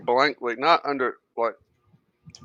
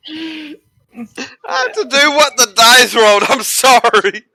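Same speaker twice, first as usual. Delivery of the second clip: laughing through the words; can't stop laughing while apologizing; laughing apology; 13 semitones higher, 11 dB louder than usual. Real, not acted. Apologizes for the dice roll.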